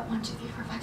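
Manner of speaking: whispered, barely audible count; counting under breath. Real, not acted. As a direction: count softly to yourself in a whisper. Counting geese outside under breath.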